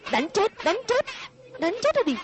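high, reckless taunt; defiant dare. Acted. Boy daring the angry man to beat him, high and reckless. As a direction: dare him to hit you, voice pitched high.

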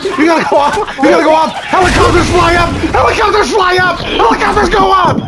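frantic yelling. Real, not acted. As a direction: yell the order at your teammate, building. Yelling orders during game escape.

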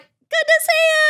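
very high, slow gushing greeting; over-the-top greeting. Retold or imitated. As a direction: gush it back, way too sweet. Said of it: "And I'm like".

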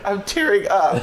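high-pitched laughing outcry; real pained, giddy yell; pained cry. Real, not acted. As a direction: cry out through pain and laughter. Eyes watering after painful pull.

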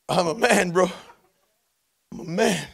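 normal talking voice

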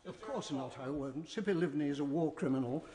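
normal talking voice

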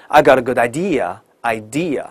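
slow I got a good idea, idea repeated; careful model of idea, no r; correct idea. Shown as a demonstration. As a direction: idea said as eye-DEE-a. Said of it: "错".

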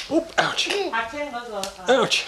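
high playful fake pain; mock ouch. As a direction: pretend to be hurt, high.

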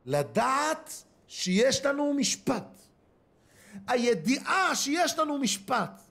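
slow, high, emphatic sermon voice; solemn preaching. Acted. Preacher warning the congregation of judgment, slow and solemn. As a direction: drive home each word about judgment.

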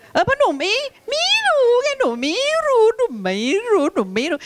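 put-on high-pitched, whining child protest; protesting pupil. Teacher plays pupil who can't read Chinese, high protesting wail.

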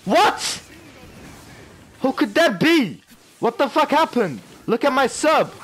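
loud, high cries of disbelief; high-pitched gamer outburst; incredulous yelling. Real, not acted. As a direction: shout it high, stunned by the game. Gamer erupts over game event.

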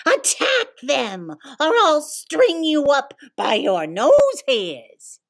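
high, menacing villain voice; villain threat. Acted. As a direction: snarl a cartoon threat.

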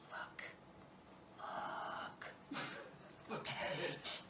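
barely voiced whisper; voiceless hushed look. Real, not acted. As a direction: breathe it in awe. Hushed showing off piece.

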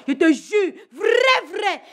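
crie la menace, voix montée; furious shout. Acted. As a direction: hurle « je te jure, vrai » en menace. Threatening brother, furious warning. (cri de colère menaçant)

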